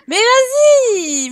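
high, loud, slow drawn-out plea; exasperated cry. Real, not acted. Streamer begs her slow Sim to move, high and loud. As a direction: drag the words out in frustration.

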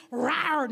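playful spoken "rawr"; lion roar. Retold or imitated. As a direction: give a little lion's roar. Imitates a lion's roar.

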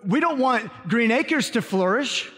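ordinary delivery

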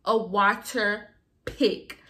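usual speaking voice

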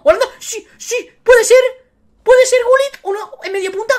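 urgent, high-pitched hoping as the reveal comes; real mounting excitement. Real, not acted. Streamer watches an icon reveal for Campesino, mounting excitement.

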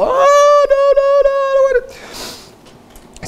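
put-on high, wailing child's plea, dragged out slow; mock bawling kid. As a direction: whine like a kid about to be spanked. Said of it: "start screaming and bawl".

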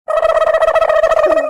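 a long, loud, high drawn-out hoo baai; loud exclamation. Acted. Aunt agrees about old school days with a long loud cry. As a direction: stretch it out loud like a shout of agreement.

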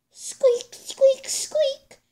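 very high squeak noises; squeaky toy. Acted. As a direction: squeak like a rubber ball toy. Dogman's squeaky ball toy.

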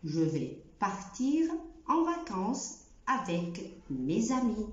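each word spaced out, slow; slow careful French. Shown as a demonstration. Teacher reading a French sentence slowly. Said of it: "الجملة التانية".

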